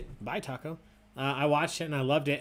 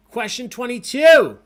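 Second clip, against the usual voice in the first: loud, raised question call; quiz announcer; 10 semitones higher, 15 dB louder than usual. Acted. Host launches the next trivia question, announcing loudly.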